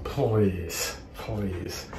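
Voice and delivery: low, soft scoffing please; dismissive. Real, not acted. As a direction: brush it off with disdain. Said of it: "dismissive scoff".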